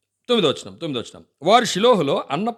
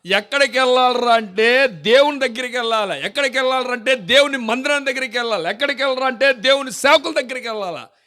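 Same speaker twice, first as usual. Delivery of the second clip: full-force preaching; 8 semitones higher, 6 dB louder than usual. Acted. Preacher urging the congregation to go to God, full-force preaching. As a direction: drive the point home to the church.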